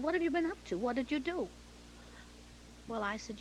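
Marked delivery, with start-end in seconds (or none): 0.0-1.5 high-pitched, lightly acted interrogation; retold questioning. Director demanding what Betty has been up to, questioning. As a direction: quiz them in a high, rising voice.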